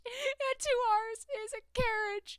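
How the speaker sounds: lightly voiced prim girl; character voice. Character voice correction.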